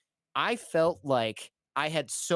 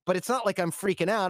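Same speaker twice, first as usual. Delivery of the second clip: defensive protest; 6 semitones higher than usual. Retold or imitated. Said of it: "And I'm like".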